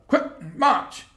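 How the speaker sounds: high sharp drill command; barked order. A narrator's reading. Officer commands his squad, sharp high barked order. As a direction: snap the order like a sergeant. Soldier barking order in story.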